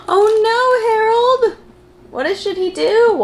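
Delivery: high, worried sing-song aside; worried storyteller gasp. Acted. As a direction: gasp in mock alarm for the little listener. Narrator's worried aside to kids.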